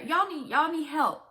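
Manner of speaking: usual speaking voice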